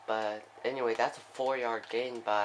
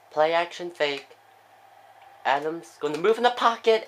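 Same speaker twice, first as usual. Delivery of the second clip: higher, louder play-by-play climbing with the play; building excitement; 6 semitones higher, 8 dB louder than usual. Real, not acted. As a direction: build the tension as the play unfolds. Tense play building in commentary.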